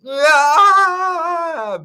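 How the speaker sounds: high, loud sung shriek; frustrated shriek. Acted. Teacher acting Balak's outburst for the class, loud high wail. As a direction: let out a big cartoon wail.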